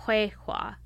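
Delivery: "Huehua" with an "ay" vowel in the first syllable; Squamish pronunciation. Shown as a demonstration. Showing how Squamish speakers say it, "Huehua" with an "ay" first. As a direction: say "Huehua" as hway-hwa, breathy in the throat. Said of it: "If you're actually in Squamish".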